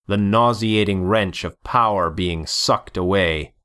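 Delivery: plain reading voice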